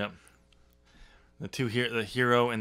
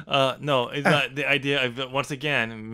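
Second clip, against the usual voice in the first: ordinary talk, nothing marked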